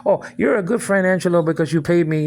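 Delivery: lightly voiced mock friend; mocking imitation. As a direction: mimic her in a sing-song sneer. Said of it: "You can't pick".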